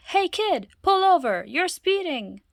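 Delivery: loud, high yell from the car; police yell. Acted. Police officer yelling at Loretta to pull over, loud. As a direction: bark it out the window.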